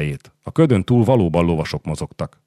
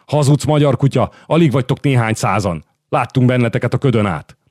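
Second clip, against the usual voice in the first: angry raised voice, high and loud to start; roared accusation; 6 semitones higher, 4 dB louder than usual. A narrator's reading. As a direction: hurl insults at the enemy commander. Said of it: "üvöltötte Kármányóla".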